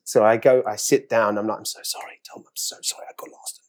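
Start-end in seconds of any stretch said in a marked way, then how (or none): 1.6-3.6 soft unvoiced whisper, sorry so nobody hears; whispering an apology to Tom at the table read.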